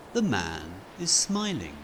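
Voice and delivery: plain reading voice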